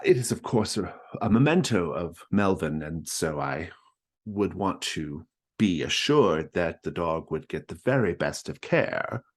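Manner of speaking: Mrs Stackpole voice. Acted. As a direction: chirp it up like a fussy hostess.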